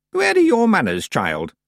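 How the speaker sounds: put-on high, loud old woman's reproof; scolding old witch. Miss Treason correcting Tiffany's manners, scolding. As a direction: rebuke the child from on high.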